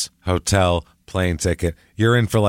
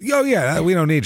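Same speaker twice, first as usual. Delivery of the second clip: high dismissive chirp; 9 semitones higher than usual. Pretend. Voicing dismissive sister.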